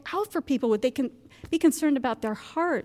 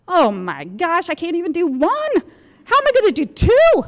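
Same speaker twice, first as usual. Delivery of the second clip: much higher and louder, half-laughing panic; mock panic; 6 semitones higher, 8 dB louder than usual. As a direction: wail it like an overwhelmed new mom. Retells panic at second pregnancy.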